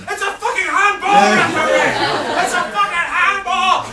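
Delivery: high, loud yell at the telly; dad furious at the referee, yelling; retold shouting. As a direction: roar at the referee on TV. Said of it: "was shouting on the T V".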